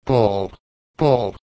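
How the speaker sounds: a hint of gentle reproach, lightly voiced; reproachful. A narrator's reading. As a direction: call his name as a mild rebuke. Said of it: "exclaimed his companion in a tone of reproach".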